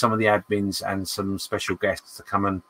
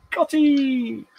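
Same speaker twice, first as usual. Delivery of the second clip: high singsong greeting. Real, not acted. High calling out name.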